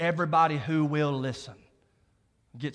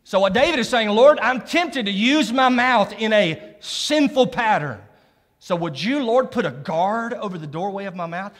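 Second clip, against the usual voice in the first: full-force preaching; 7 semitones higher, 9 dB louder than usual. Acted. Preacher voicing David's prayer to the church, loud preaching. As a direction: pray it big for the whole church.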